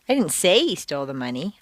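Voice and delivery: "say" lifted and emphasized; stress on SAY. Shown as a demonstration. Teacher only suggested the possibility, stress on "say". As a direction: lean on "say" to imply only hinting. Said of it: "I only suggested the possibility".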